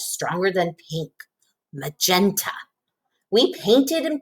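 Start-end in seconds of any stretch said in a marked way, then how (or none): none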